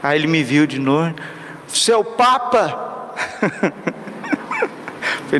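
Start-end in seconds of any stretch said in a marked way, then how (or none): 1.7-3.1 homeless man asking. Retold or imitated. Homeless man asks priest. 3.2-5.2 a high airy giggle with no voice; high breathy laugh. Retold or imitated. Homeless man laughing at the priest, high breathy laugh.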